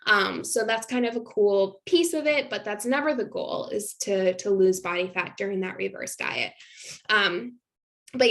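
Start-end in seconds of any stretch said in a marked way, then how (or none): none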